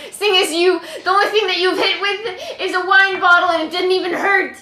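laughing through a teasing jab; laughing mockery. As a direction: tease him while laughing. Mocking Connor, laughing.

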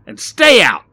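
loud, high angry snap; snapped. A narrator's reading. Barry snaps at Rodney thrown onto the pavement. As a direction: snap it as a last warning. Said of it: "snapped Barry".

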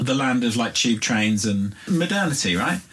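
put-on high, lively Irish accent. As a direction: tell it in a broad Irish accent. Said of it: "I'm about to do an Irish accent".

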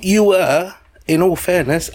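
normal talking voice

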